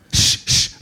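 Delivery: two sharp shushes; urgent shushing. Acted. Blind man to his buddy as crowd passes, shushing. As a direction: shush your buddy to listen.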